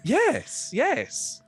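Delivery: very high, excited approval; delighted elf bard. Acted. Elf bard thrilled someone bows to him, delighted. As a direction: squeal approval in a lofty voice.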